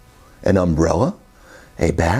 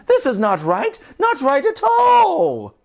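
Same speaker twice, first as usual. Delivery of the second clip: dismayed sisters; 13 semitones higher than usual. Acted. Sisters lamenting the ruined coaster, high voice. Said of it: "cried the sisters".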